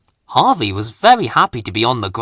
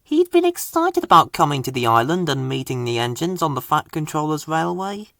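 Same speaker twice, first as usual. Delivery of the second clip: ordinary delivery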